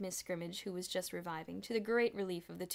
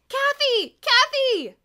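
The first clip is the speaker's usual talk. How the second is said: very high, loud repeated call; 18 dB louder, much slower than usual. A narrator's reading. Diane calling out to Kathy loudly. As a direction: call her across the crowd.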